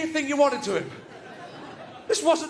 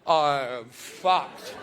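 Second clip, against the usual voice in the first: low, slow, groggy mumble; a hint of a knocked-out fighter; dazed fighter; 10 semitones lower, much slower than usual. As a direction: sound stunned and beaten.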